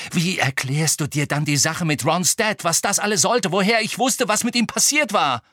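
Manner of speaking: loud, rising heated argument; angry challenge. A narrator's reading. Harry confronts Hermine about Ron's dad, heatedly. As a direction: build in pitch and force as you demand an explanation.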